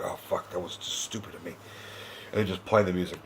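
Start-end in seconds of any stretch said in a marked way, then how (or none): 0.0-1.5 quick, much softer regretful aside; deflated mutter. Pretend. Caught out after the chant, muttering to himself. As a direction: mutter it fast under your breath, deflated. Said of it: "I'm like".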